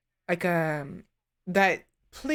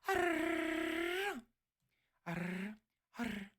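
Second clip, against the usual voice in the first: pirate growls; 5 semitones higher, 10 dB quieter, slower than usual. Shown as a demonstration. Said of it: "doing random shit".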